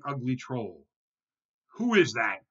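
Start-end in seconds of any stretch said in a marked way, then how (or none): 1.8-2.4 loud, sudden challenge; troll's roar. A narrator's reading. Troll challenges tiny goat on his bridge, loud roar. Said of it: "roared the troll".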